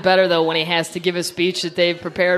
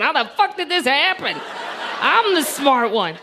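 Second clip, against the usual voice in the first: mock Jeb Bush; 6 semitones higher than usual.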